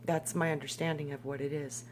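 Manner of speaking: ordinary talk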